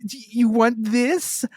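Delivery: put-on high, slow anime girl voice; overacted anime dub. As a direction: ham it up like an anime character. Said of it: "You sound like an anime character".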